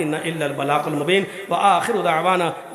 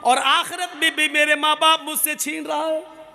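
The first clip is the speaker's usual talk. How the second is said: raised, fervent sermon storytelling voice; impassioned preaching; 12 semitones higher than usual. Acted. Preacher telling the congregation of children in paradise, fervent preaching. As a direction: preach it with rising fervour to the crowd.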